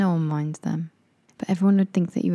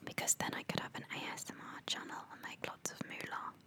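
breathy unvoiced whisper; soft conspiratorial whisper; 21 dB quieter than usual. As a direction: whisper it like an ASMR video.

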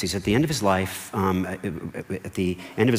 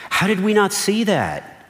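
much higher, slow, amazed cry; astonished crowd; 12 semitones higher, 4 dB louder, slower than usual. Retold or imitated. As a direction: gasp it out like a stunned crowd. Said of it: "everybody in the room is saying".

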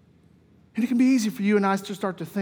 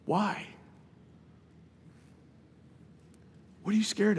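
Jesus gently calming scared disciples; tender reassurance; 5 dB quieter than usual. Retold or imitated. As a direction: comfort a frightened friend. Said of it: "In my sanctified imagination".

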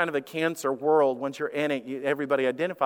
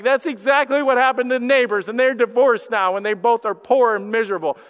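mock doom spiral; 6 semitones higher, 7 dB louder than usual. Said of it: "minds naturally go to the darkest places".

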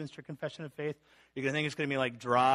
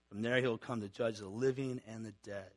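ordinary talk

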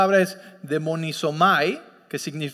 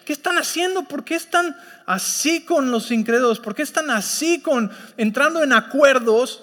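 higher, pressing rebuke of a wayward church; scolding apostle; 3 semitones higher than usual. Retold or imitated. Preacher voicing Paul scolding the church, higher rebuke. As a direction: scold them like Paul warning his flock. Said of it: "Él está como que regañándole".

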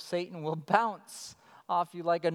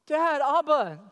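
raised, louder appeal; calling out to Father; 6 semitones higher, 7 dB louder than usual. Acted. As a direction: call on God like a child calling Dad. Acts believer calling on God.

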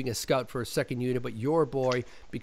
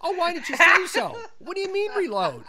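very high, incredulous comeback; mock realization; 4 dB louder than usual. Stand-in answering the screaming director, high mock astonishment. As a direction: act out comic relief and disbelief. Stand-in reacts to misheard order.

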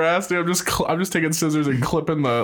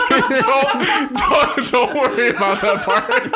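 high, pleading through laughter; laughing; 8 semitones higher, slower than usual. Real, not acted. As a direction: beg while cracking up.

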